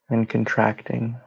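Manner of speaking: calm, even meditation voice with long pauses; guided meditation. Real, not acted. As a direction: guide them gently into rest, phrase by phrase.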